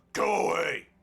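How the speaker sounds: loud, curt brush-off; butcher shouts. Acted. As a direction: bark it at the boy. Butcher dismissing the boy.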